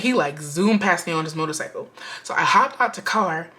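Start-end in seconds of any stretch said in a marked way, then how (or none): none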